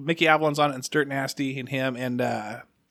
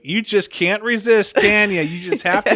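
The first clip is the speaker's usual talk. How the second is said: high, exasperated mock scolding; Kristen; 6 semitones higher, 5 dB louder than usual. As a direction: imitate a woman fed up with a repeat prankster. Imitating Kristen scolding him.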